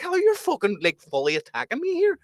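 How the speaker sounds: retold outrage. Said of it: "I was like".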